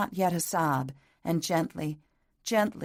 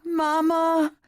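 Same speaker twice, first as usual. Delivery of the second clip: put-on high, loud childlike wail for her mother; pitiful wail; 12 semitones higher, 7 dB louder than usual. A narrator's reading. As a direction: cry out like a lost little girl. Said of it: "she wailed pitifully into the dark".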